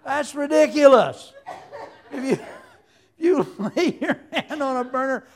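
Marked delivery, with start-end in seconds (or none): none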